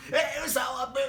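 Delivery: Swally yelling after the crash on the bar; startled shout. Retold or imitated. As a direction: jump out of your skin and shout. Startled outburst retold.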